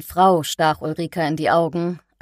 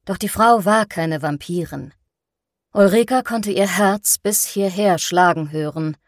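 usual speaking voice

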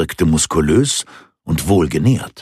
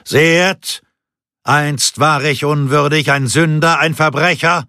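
higher, ringing proclamation to the crowd; thundering voice; 7 semitones higher than usual. Acted. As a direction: preach to the townsfolk, pitched high. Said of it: "mit donnernder Stimme".

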